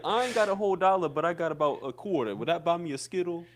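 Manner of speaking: a hint of a hearty old uncle haggling. Unc asking the store clerk if a quarter buys a Skittle, lightly voiced. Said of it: "He'd be like".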